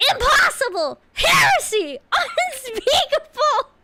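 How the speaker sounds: shouted high outrage; outraged cry. Acted. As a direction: cry out in holy horror. Acted outraged angel outburst.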